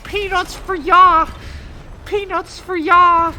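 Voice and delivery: very high, very loud cackling call; shrill flight attendant hawking peanuts down the aisle; squawking flight attendant. Retold or imitated. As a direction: belt out peanuts like a clucking chicken. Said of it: "she started going".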